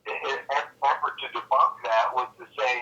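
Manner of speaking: ordinary talk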